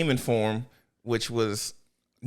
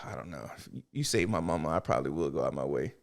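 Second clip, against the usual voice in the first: low, much softer voice; mock bashful admission; 11 semitones lower, 8 dB quieter, faster than usual. Admitting he would go out of his way, low and soft. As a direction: drop it down to a soft murmur.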